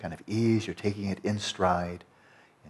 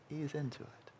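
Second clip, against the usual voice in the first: softer hushed repeat of "Ease into it"; much softer, soothing; 6 semitones higher, 13 dB quieter than usual. Shown as a demonstration. Teacher calming students into the dream, softly soothing. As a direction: let "Ease into it" fall away almost to a murmur. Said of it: "But chill, you know, relax".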